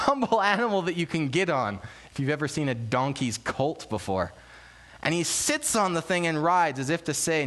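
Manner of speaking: normal talking voice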